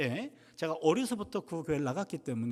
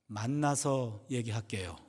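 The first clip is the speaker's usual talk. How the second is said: slow, low and measured on "만나서 얘기할게요"; insistent repeat; 8 semitones lower, slower than usual. Retold or imitated. Deaconess refusing to explain by phone, slowly insistent. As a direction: repeat it slowly and deliberately, giving nothing away.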